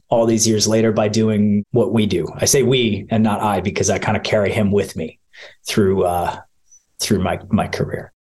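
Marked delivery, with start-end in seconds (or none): 3.6-8.1 low, halting, getting emotional; tearing up. Real, not acted. Sportscaster remembering his late uncle to interviewer, getting emotional. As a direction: voice sinking as real emotion catches you. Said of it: "I'm starting to tear up now".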